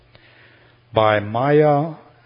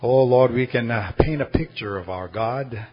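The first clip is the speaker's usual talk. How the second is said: soft, low, measured praying voice; closing prayer; 5 semitones lower, 5 dB quieter than usual. Real, not acted. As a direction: pray gently, pausing between phrases, sinking to amen. Leads congregation in closing prayer.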